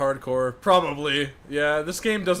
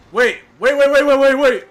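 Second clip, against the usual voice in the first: higher, louder rapid waits; panicked shouting; 7 semitones higher, 9 dB louder than usual. Real, not acted. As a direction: yell wait in a panic. Panicked in a fight.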